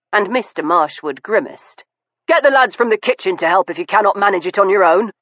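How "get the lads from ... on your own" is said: rapid dismissive command; fast curt order. Acted. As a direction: toss the instruction over your shoulder. Ordering butler.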